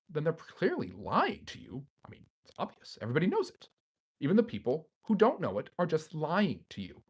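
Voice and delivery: a mock Tucker Carlson accent delivering a smug claim. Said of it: "has the best Tucker Carlson accent".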